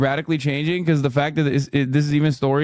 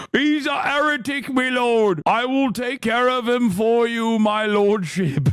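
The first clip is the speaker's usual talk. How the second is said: much higher, slow mock peasant voice; medieval peasant; 7 semitones higher, slower than usual. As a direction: ham up a fawning medieval servant. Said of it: "a medieval peasant take".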